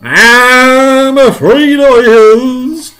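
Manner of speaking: very slow, high, loud wail; alarmed wail. Acted. Police chief confirming the worst, long alarmed wail.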